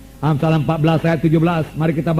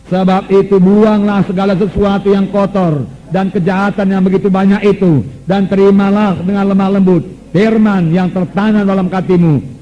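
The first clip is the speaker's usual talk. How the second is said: slow, higher and louder proclamation; fervent scripture proclamation; 4 semitones higher, 4 dB louder, slower than usual. Acted. Preacher proclaiming the verse to the church, fervent. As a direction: proclaim it with full force.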